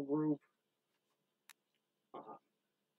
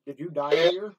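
much louder, rising call into darkness; calling into the dark; 2 semitones higher, 10 dB louder than usual. Real, not acted. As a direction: shout the question to the spirits. Calling out to spirits.

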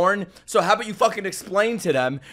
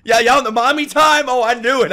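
excited; 7 semitones higher, 7 dB louder than usual. Real, not acted. Hyped reaction continues.

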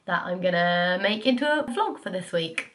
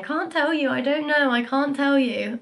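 lightly higher, a hint of a small boy dodging; little brother's refusal; 6 semitones higher than usual. Little brother refusing his nagging sister, lightly higher mock child voice. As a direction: mimic a little brother holding out. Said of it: "and he kept saying".

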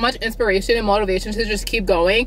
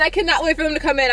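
normal talking voice

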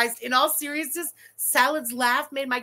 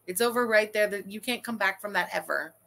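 usual speaking voice

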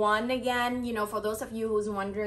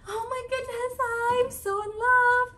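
gushing squeal; 13 semitones higher than usual. Real, not acted. Vlogger swooning over her new Chanel bag, high squeal. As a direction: squeal like you just fell in love.

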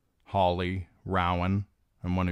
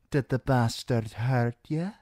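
put-on higher accented woman's voice; probing washerwoman; 6 semitones higher than usual. A narrator's reading. Rowan asks Theon about torture, washerwoman voice. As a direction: question him in a raised character voice.

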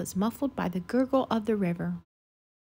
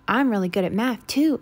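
fast, louder boastful exclaim; 7 dB louder than usual. Acted. Thistle exclaiming to Goose, fast and boastful. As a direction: rattle it off showing off. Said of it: "exclaimed Thistle".

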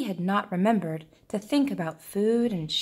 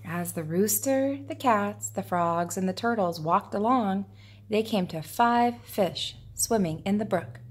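usual speaking voice

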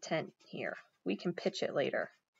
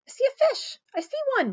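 high, loud excited outburst; excited cry; 6 dB louder than usual. A narrator's reading. Amelia Bedelia spots a fish, excited. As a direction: call out the sighting, voice jumping up.

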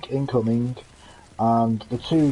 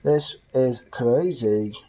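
very slow, stretched-out words; drawn out; 2 semitones higher, much slower than usual. Real, not acted. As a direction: stretch every word out in disbelief. Marvelling at the chaos.

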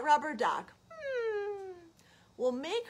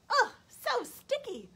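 high squeaky pig complaining; pig character; 11 semitones higher, slower than usual. Acted. The pig fussing about being sticky, high and whiny. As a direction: whine it like a sticky piglet.

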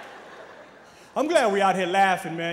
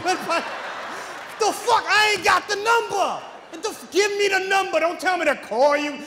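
frantic shout; 8 semitones higher, slower than usual. Retold or imitated. Comic caller desperate for FBI number, shouting high. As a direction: scream for the number in a panic.

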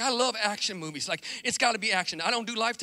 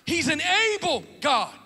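"able" stretched slow and high, shouted; stress on able; 5 semitones higher, 4 dB louder, slower than usual. Shown as a demonstration. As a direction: lean hard on "able", slowly. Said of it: "Let me say it one more time".

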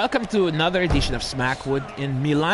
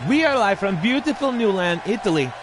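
ring announcer; 5 semitones higher than usual. Pretend. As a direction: open the show to the crowd. Host opens show like announcer.

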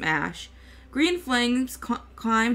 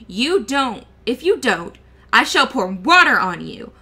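loud stern threat; fierce threat; 7 dB louder than usual. A narrator's reading. Sophie threatens Calcifer with water, ferocious. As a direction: make her mean it. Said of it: "ferocity that had often stopped both her sisters".

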